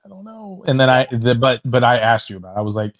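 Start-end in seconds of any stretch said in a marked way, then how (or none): 0.0-0.6 unsure, halting, with long pauses; uneasy first reaction, retold; hesitant doubt. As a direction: trail up high and soft in doubt. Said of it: "the first time I heard it".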